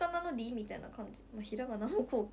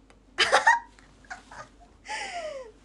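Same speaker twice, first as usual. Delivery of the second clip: shrieking laugh; 11 dB louder than usual. Real, not acted. Big laugh.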